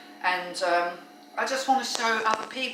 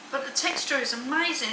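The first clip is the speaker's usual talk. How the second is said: ordinary delivery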